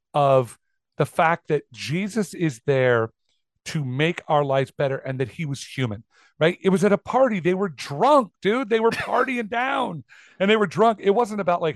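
ordinary delivery

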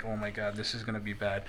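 low, very soft imitated groaning to himself; dreading aside. Robert Gibson dreading his partner's routine, hushed imitation. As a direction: say it under the breath, bracing for trouble. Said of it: "rubbing them, and he's like".

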